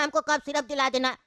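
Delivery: ordinary delivery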